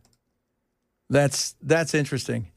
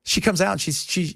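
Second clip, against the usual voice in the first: ordinary talk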